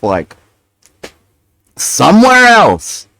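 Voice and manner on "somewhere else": high, slow exasperated plea; drawn-out fed-up question. Real, not acted. Exasperated at neighbors.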